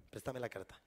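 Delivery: ordinary talk, nothing marked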